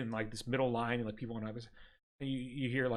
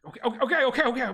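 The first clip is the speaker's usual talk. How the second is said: higher, quick nervous okays; flustered applicant; 8 semitones higher, 4 dB louder, much faster than usual. Acted. Applicant retreats from the clerk, flustered. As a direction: back off hastily.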